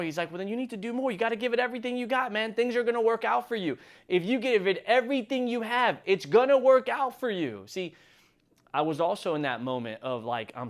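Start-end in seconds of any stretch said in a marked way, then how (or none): 0.3-7.6 imitated emphatic encouragement. Retells mentor's emphatic encouragement.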